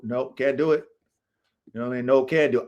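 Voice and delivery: normal talking voice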